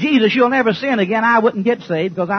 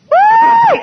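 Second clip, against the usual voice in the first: whoop; 9 dB louder than usual. Real, not acted. Preacher whoops at crowd.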